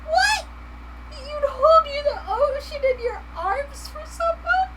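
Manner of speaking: very high, tearful-sounding squeal; hammed-up squeaky emotional gushing; swooning reaction. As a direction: melt into a high, drawn-out mock cry. Swooning reaction to the sweet lyric.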